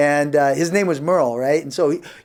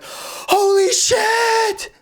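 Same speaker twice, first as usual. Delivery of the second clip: much higher, slow drawn-out astonishment; stunned; much slower than usual. Retold or imitated. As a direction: drag out shocked disbelief. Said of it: "we were like".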